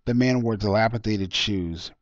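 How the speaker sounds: usual speaking voice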